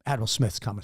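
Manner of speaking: hissed warning, voiceless and low; urgent whisper. Retold or imitated. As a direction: whisper it fast so the admiral won't hear. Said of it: "He's like".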